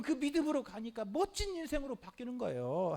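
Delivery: usual speaking voice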